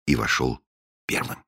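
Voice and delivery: ordinary delivery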